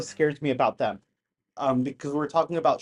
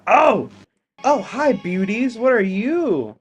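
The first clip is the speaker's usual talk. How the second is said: higher, louder delighted hello; excited, slowed-down what are you; delighted greeting; 6 semitones higher, 6 dB louder, slower than usual. Real, not acted. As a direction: greet them with wonder. Delighted at new sighting.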